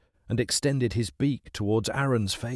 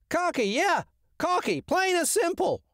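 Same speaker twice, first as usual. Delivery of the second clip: very high parroting squawk; mimicking crow. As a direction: echo it like a cheeky crow. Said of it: "mimicked the crow".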